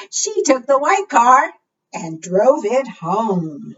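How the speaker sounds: singsong storytime read-aloud; reading to children. Acted. Librarian reads the car rhyme to children, lightly singsong. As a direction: read it out to the little ones.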